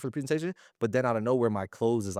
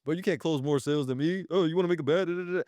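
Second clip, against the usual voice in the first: cocky sales rep; 5 semitones higher than usual. Retold or imitated. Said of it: "Everybody goes into the office and what is".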